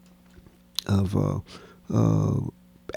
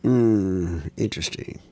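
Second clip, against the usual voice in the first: unimpressed; 5 semitones lower, 4 dB quieter, slower than usual. Retold or imitated. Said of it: "he looked at me like".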